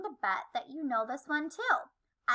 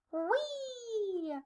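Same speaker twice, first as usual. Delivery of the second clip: excited whee; 7 semitones higher, 7 dB quieter than usual. A narrator's reading. Swinging down a vine.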